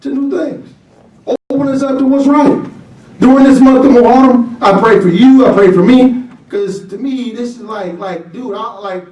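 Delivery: loud, ringing sermon delivery; fiery preacher's voice; preaching at full force. Acted. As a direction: preach it out to the whole room.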